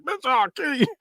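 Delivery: lightly voiced high Freddy threat; a hint of a sneering killer. Freddy threatens the host's victim.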